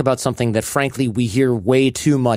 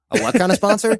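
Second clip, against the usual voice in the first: high-pitched incredulous question; mock bafflement; 9 semitones higher than usual. Host telling Jason of the odd sponsor, mock baffled. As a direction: replay your puzzled double take, pitched up.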